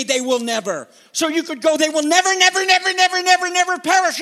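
preacher driving home that they will never perish, loud; emphatic build. Shown as a demonstration. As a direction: pound out every never, climbing. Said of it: "It can't be said more powerfully".

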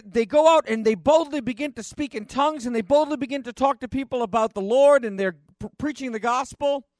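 high, loud, fervent preaching; rising revival preacher's cry; preaching at full force. Acted. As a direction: lift the whole room with it.

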